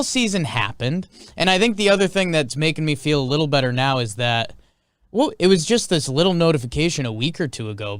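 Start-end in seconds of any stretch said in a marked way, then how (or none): none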